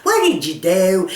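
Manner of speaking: lightly voiced daughter asking in disbelief; imitating shocked daughter. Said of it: "that's how they all say".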